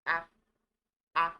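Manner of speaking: A said as a short open "ah"; French letter A. Shown as a demonstration. Teacher showing learners the French alphabet, A as "ah". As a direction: say A as a crisp French "ah". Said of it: "This is pronounced A".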